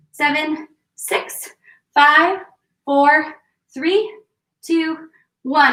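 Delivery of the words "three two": usual speaking voice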